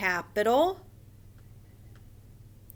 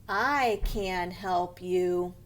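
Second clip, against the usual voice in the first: slow, even statement settling down on YOU; plain statement; 3 semitones lower, much slower than usual. Shown as a demonstration. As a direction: read it plainly, no lift on YOU. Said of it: "we just say it plain".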